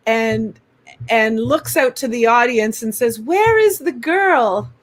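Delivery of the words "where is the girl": high, slow, searching call; lightly voiced celebrity question; imitating Olivia. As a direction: mimic a star asking the crowd.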